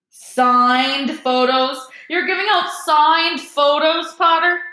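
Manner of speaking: slow, high, drawn-out scornful jeer; loud and scathing. A narrator's reading. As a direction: call it out mockingly, stretching the words. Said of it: "Loud and scathing".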